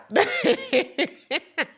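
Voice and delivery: high ringing laugh; delighted laugh. Real, not acted. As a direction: laugh into the mic. Laughs at own joke.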